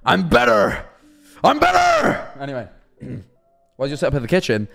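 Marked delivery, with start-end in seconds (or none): 0.0-0.8 loud, rough bellow at normal pitch; mock boast shout. Mock boast shouted after closing door. 1.4-2.3 very high, loud scream; over-the-top screeching brag; screamed mock boast. Repeats the boast even harder.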